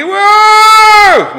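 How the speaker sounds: long, very high, loud whooping whoa; wild whoa yell. Retold or imitated. Irish charging the bikies with a loud high whoooa. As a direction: let out a huge drawn-out whoa. Irish yelling running downhill.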